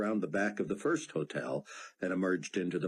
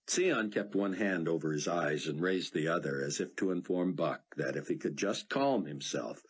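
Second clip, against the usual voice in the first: ordinary delivery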